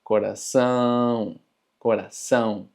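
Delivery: coração with a nasal "ão"; sonido nasal. Shown as a demonstration. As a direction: repeat coração as a model, ão through the nose. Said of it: "indica un sonido nasal". (coração con «ão» nasal)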